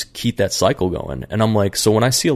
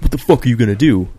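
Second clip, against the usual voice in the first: fast, louder mock tough-guy challenge; aggressive taunt; 3 semitones higher, 5 dB louder, faster than usual. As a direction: bark it like a bully squaring up. Bully squaring up on bus.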